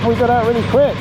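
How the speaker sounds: excited. Real, not acted. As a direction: burst out with glee. Excited after leaving the ship.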